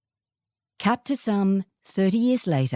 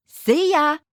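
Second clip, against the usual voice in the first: cheery Aussie goodbye; 8 semitones higher, 4 dB louder than usual. Retold or imitated. Aussie friends calling goodbye.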